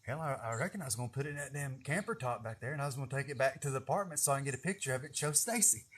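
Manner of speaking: quiet, quick sheepish answer; sheepish self-answer. Retold or imitated. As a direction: mumble the excuse fast, embarrassed. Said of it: "I remember I was like".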